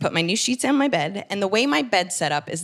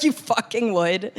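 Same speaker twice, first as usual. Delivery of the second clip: wry self-reproach; slower than usual. Retold or imitated. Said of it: "I'm like".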